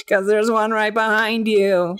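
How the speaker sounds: high, slow put-on character voice; imitated odd kid, high-pitched and dragging; Jason Funderburker. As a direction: deliver a creepy punchline, drawn out and high. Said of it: "every single one of Jason Funderburker's lines".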